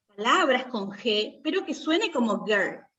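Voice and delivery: ordinary delivery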